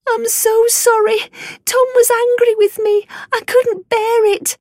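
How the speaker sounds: put-on very high, fast, upset girl's voice; upset little girl. A runaway daughter confesses to her father, high and rushed. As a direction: blurt it out like a tearful child.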